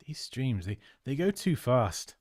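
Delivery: ordinary talk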